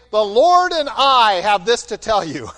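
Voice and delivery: booming mock decree; mock grand proclamation. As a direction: ham up a self-important prophet. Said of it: "Does he say".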